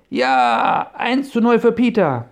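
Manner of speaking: loud, high victory cheer. Acted. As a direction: celebrate the goal at full voice. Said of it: "jubelte Bob".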